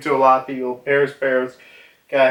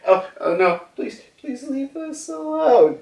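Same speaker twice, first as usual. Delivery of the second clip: much higher, pleading whine; mock begging; 11 semitones higher than usual. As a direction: beg the flame-wielder for mercy. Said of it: "they're like".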